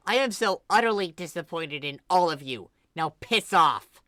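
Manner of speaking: fed-up sister ordering them out; dismissive anger. Acted. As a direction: send them away for good.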